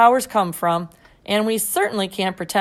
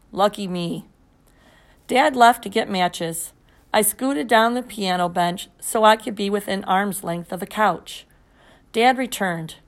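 ordinary delivery

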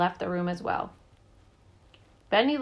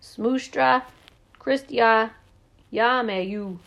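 loud, mock-burped Russian words; burping in Russian; 3 semitones higher, 5 dB louder, much slower than usual. A narrator's reading. Dad discovering he can burp in Russian, burping. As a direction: push out each foreign word like a burp. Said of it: "He opened his mouth and burped".

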